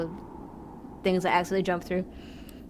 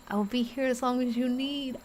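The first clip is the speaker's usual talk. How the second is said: higher, soft promise; soft, tender, a little higher; tender reading; 4 semitones higher, 4 dB quieter than usual. Acted. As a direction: say it like a quiet vow of comfort. Reads game's tender line.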